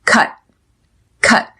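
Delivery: "Cut" with the final T held, not released; stop T. Shown as a demonstration. Teacher demonstrating the stop T, "Cut" ending unreleased. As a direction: press air out on "Cut" and stop it dead. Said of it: "I press air out of my mouth and stop".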